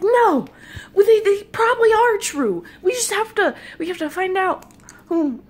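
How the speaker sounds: loud, high-pitched excited protest; urgent insistence. Retold or imitated. Dreamer urging the lady gnome to investigate, loud and frantic. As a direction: burst out, desperate to convince.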